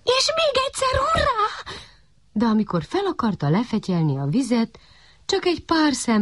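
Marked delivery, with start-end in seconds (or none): 0.0-1.7 very high squealing hooray; puppy's cheer again. A narrator's reading. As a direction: cheer once more, bursting with joy. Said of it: "Újjongott fel a kiskutya". (magas, újjongó kiáltás)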